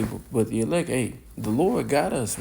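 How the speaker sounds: ordinary talk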